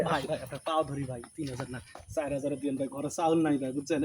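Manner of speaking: low, hushed pleading; begging. Acted. Husband begs customer for more money. (নিচু গলায় কাকুতি-মিনতি)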